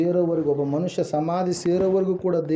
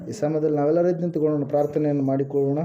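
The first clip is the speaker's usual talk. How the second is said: quick call to prayer; 3 semitones lower, much faster than usual. Real, not acted. Calling congregation to stand, pray.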